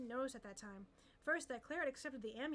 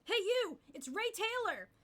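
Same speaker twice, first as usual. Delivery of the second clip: calling out; 9 semitones higher, 7 dB louder, slower than usual. Acted. Crowd calls out to her.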